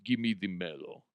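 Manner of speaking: put-on low, quiet Russian accent; Russian billionaire soundbite. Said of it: "we used to have the soundbite".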